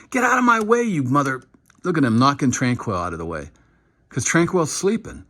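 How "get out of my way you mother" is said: high, pushy mock trash talk as he blocks; imitating a player's trash talk. Player shoving a linebacker aside, mock trash talk. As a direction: bark it like a lineman bulldozing through.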